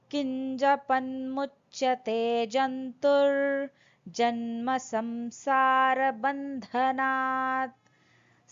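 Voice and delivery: slow, measured Sanskrit chant; over enunciating. Acted. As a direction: recite every syllable carefully and slowly. Sanskrit verse recited before translation.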